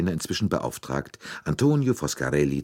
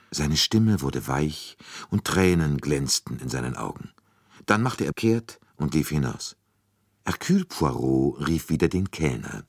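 plain reading voice

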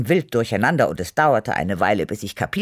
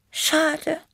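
very high little cat voice, wistful; sighing. A narrator's reading. Tippi the cat regretting the music stopped, sighing. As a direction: sigh it in a high, dainty cat voice. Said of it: "seufzte Tippi".

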